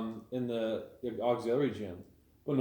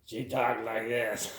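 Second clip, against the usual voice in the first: put-on slow, raspy old smoker voice; smoker's rasp; much slower than usual. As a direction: drag it out like a chain smoker. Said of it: "She had that gravelly old smoker's voice".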